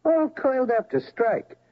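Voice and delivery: usual speaking voice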